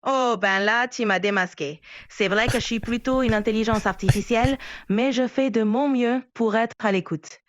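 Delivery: light Québécois French accent; Quebec accent. Real, not acted. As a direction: speak with a mild Quebec lilt. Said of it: "arrêter avec l'accent québécois".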